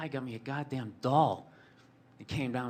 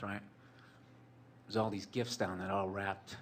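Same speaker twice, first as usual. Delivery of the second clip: usual speaking voice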